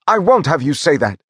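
screamed. A narrator's reading. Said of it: "screamed Monty".

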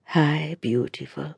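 man greeting her softly at the market; soft whisper. A narrator's reading. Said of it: "he whispered".

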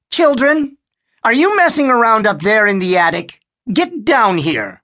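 put-on mother calling kids down from the attic. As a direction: call up to the attic, stern.

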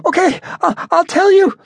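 high, loud begging in pain; twisted arm, begging the detective to stop; pained pleading. A narrator's reading. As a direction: give in, gasping and scared. Captive giving in under pain.

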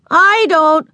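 put-on high, loud little-kid retort; defiant. A narrator's reading. Little sister contradicts older sister, defiant.

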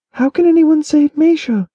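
high, loud bewildered question; Keliana's voice, confused. A narrator's reading. Daughter questions father and Edric, high and bewildered. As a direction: she demands to be let in on it.

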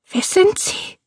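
put-on high, breathless frightened old woman. A narrator's reading. Old woman facing two intruders, breathless. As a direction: gasp the question in fear. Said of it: "fragte sie atemlos".

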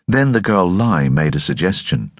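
plain reading voice

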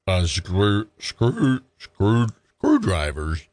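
lightly voiced stammering drunk; slurring drunk. Drunk Freddie fumbling his answer to the cop, slurred. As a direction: stumble over the word screw like a drunk.

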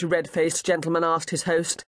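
ordinary delivery, nothing marked

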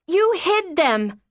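high, drawn-out accusation; mild scream. A narrator's reading. Daughter accusing mother of hiding letters, restrained scream. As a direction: cry it slowly in a high child's pitch, short of screaming. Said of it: "Violet screamed".

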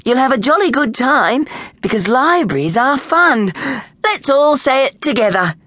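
high, jolly, over-keen librarian; rally the children in a high voice; cheery librarian. A narrator's reading. Librarian rallies bored children.

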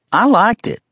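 Southern belle lilt, pleased; southern accent. A narrator's reading. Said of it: "she said in a southern accent".

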